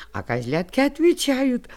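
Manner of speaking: plain reading voice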